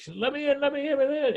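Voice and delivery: high-pitched mock pleading; imitated pleading. Retelling the guy pounding on the door, high imitated plea. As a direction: imitate someone begging to be let in.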